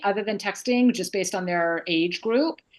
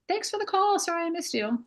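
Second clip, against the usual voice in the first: high, light imitation of a reply; lilting mock client, pitched way up; client's text reply; 10 semitones higher than usual. Client texting back after a missed call, light and high-pitched. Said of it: "I'll usually get a text reply".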